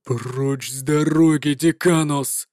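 low, slow threatening growl; a paladin growling at a girl to move; menacing growl. Acted. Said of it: "прорычал Лихтер угрожающим тоном".

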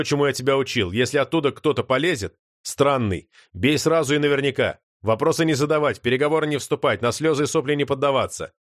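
plain reading voice

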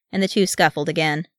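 ordinary delivery, nothing marked

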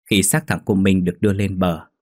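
usual speaking voice

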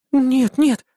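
put-on high bright girlish voice; young woman voice. A narrator's reading. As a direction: voice the demon girl cheerfully. Irda recovering.

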